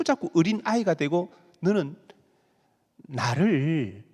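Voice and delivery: normal talking voice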